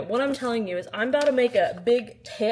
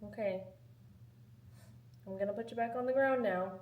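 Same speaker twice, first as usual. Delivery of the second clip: usual speaking voice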